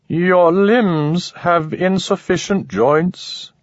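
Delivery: a curious creature commenting coolly; alien voice. A narrator's reading. Narrator voices alien Manek.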